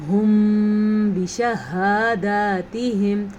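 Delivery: ھم بشہاداتہم hummed through closed lips, slow; nasal meem gliding into ba; ikhfa shafawi. Shown as a demonstration. Teacher answering how ikhfa shafawi sounds. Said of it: "کیسے؟".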